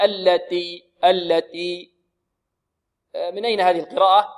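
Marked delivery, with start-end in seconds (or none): 0.0-1.9 "التي" with a dry t close to d; unaspirated t, mistaken. Shown as a demonstration. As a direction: imitate students stripping all breath from the t in "التي". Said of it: "يجرد التاء من الهمس". (نطق خاطئ لـ"التي" بتاء مجردة من الهمس)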